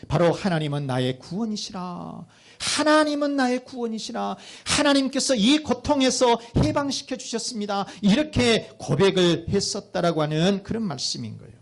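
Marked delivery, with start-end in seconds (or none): 2.6-7.9 high-pitched, fervent proclamation; crying out thanks. Retold or imitated. Preacher voicing the returned people of Judah praising God, crying out. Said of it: "이 외쳤던 감사가 뭐냐면".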